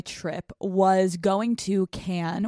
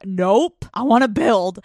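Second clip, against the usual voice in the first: mock stubborn refusal; 7 dB louder than usual. Young self refusing mom's offer.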